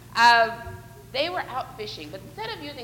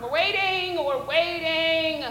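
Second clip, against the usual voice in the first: drawn-out waiting; 3 semitones higher, much slower than usual. Pretend. Leader to kids waiting for pretend bites, drawn-out singsong. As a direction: stretch the wait out playfully.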